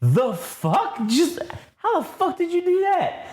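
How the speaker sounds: high, cracking disbelief; stunned disbelief. Retold or imitated. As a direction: squeak out your amazement. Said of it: "Vader's just like".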